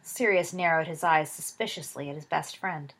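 ordinary delivery, nothing marked

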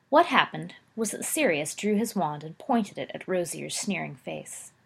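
ordinary delivery, nothing marked

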